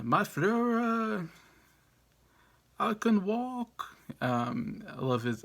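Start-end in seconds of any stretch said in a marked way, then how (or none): 0.0-4.0 put-on high, slow, over-the-top German accent as Strangelove; outrageous German accent. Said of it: "he's got this outrageous German accent".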